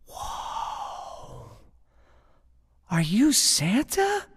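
breathed wonder, then a high slow question; awed little boy. Acted. Little boy finding Santa half undressed, awed. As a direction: ask it slowly as an awestruck child. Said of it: "And the boy says".

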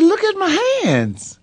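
high, pleading, half-sobbed; retold crying plea. Crying to his dad about his hands. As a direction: plead through tears. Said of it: "And I'm crying".

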